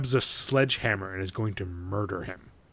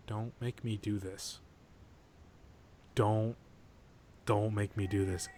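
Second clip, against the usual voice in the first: slow, soft plea with long pauses; quiet pleading; 3 semitones lower, 7 dB quieter than usual. Retold or imitated. Man in front of a mirror shaving, quiet slow pleading. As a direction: imitate a man begging his reflection, hushed. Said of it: "looking himself in the mirror saying".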